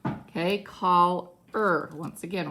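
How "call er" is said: "call er" as two separate syllables, er louder; split, stressed ending. Shown as a demonstration. Teacher pointing out the er sound, "call er" split. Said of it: "we hear the er".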